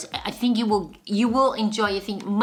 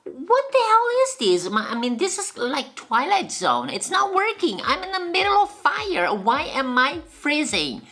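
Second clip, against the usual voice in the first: baffled outburst; 8 semitones higher than usual. Retold or imitated. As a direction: blurt it out, totally baffled. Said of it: "And I thought".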